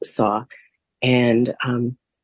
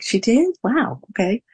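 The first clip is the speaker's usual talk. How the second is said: retold surprise; 7 semitones higher than usual. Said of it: "I was like".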